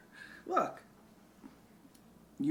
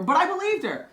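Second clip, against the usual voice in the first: emphatic; 11 semitones higher, 9 dB louder than usual. Real, not acted. Insisting she believed the ring.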